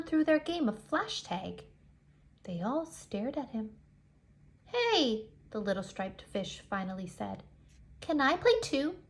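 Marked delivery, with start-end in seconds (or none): 4.6-5.3 bright higher hail; little striped fish calling. Acted. Little striped fish greets the school, higher voice. 8.0-9.0 higher hopeful ask; little striped fish asking. Acted. Outsider fish asks the school to play, higher.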